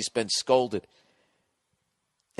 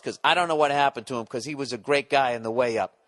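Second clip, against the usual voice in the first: usual speaking voice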